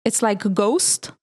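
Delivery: slowly, "ghost" with a long O; pronunciation hint. Retold or imitated. Host quoting the singer's rhyme for his name, slow long O in ghost. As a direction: draw out the OH in ghost. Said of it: "how I'm supposed to pronounce your name".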